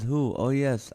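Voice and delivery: ordinary talk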